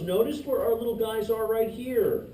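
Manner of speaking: normal talking voice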